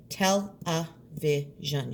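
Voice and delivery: "Television" slow, each beat clear; "Television" split into four beats. Shown as a demonstration. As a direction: tap out "Television" slowly. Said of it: "You can hear those four beats".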